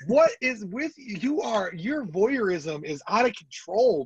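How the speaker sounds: mock outrage. Real, not acted. Teasing friend about voyeurism.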